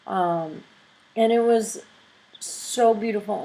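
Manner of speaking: usual speaking voice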